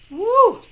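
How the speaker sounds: loud, high "woo" release; high whoop. Real, not acted. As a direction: blow off energy with a whoop. Releasing breath after yawning.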